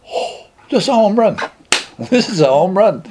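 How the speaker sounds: high-pitched retold glee; elated. Drinker promised a drink by his roommate, elated. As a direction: celebrate a lucky break, pitched well up.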